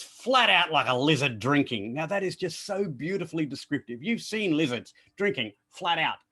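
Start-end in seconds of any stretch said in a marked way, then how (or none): 0.0-1.9 a relished Australian saying; broad Australian, relished; Aussie saying. Shown as a demonstration. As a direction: savour it like a favourite phrase. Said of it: "Here's one of my favourites".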